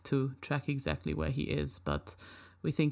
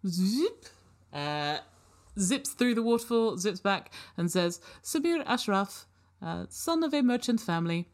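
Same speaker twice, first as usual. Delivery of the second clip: polite robot; 6 semitones higher than usual. Acted. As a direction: answer like a helpful but clueless robot. Robot reports record.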